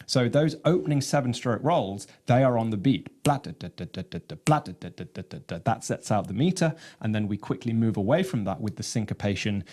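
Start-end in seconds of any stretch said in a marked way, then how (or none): 3.2-5.6 low, even "da" syllables with an accented "blah"; chanted rhythm syllables, first of each group accented; vocalized drum rhythm. Shown as a demonstration. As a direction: voice a drum roll pattern on one low note.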